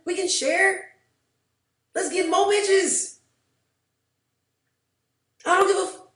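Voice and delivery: high, loud, wild rant; hyped yelling. Retold or imitated. Shouting at her date about the house, hyped. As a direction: scream it like you've won the jackpot. Hyped reply to his confession.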